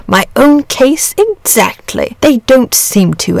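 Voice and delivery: slow, emphatic fellow feeling; crying out in agreement. A narrator's reading. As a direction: stretch each word in eager agreement. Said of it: "cried the dragon".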